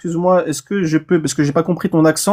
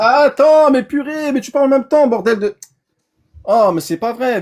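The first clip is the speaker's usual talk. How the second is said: higher, louder, fed up with being talked over; exasperated, loud and high; exasperated outburst; 8 semitones higher, 4 dB louder than usual. Real, not acted. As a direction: snap in real frustration, pitch way up. Flustered while opponent keeps talking.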